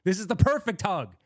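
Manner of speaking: high, playful insistence; mock insistent hugger. Telling Brian the too-tight hug is perfect, playfully high and insistent. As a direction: refuse to let go, teasingly high. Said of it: "I'll be like".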